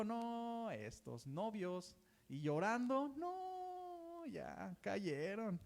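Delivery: hammed-up weepy church sister; mock tearful sister. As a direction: wail it slowly as if the couple fell. Imitating the sister, crying.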